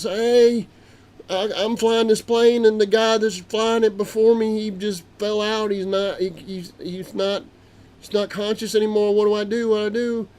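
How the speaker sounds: high, loud, urgent plea for help; panicked radio call. Retold or imitated. Grandpa calls the tower in a panic after the pilot passes out.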